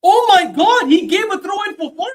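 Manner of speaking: high, loud shout of protest; shouted, high-pitched fan outrage; outraged disbelief. Real, not acted. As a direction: yell in real disbelief at the referee. Outraged at referee's decision, live.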